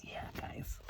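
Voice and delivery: soft unvoiced whisper. Real, not acted. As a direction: breathe it as a quiet aside. Parent whispers back.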